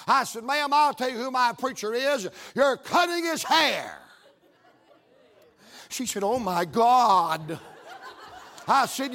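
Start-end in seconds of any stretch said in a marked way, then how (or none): 0.4-4.0 loud-edged, higher, triumphant reveal; bold declaration. Retold or imitated. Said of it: "a case of Holy Ghost boldness come on me". 6.2-7.6 slow, loud, drawn-out groan of horror; mock dismay. Barber reacts to the reveal.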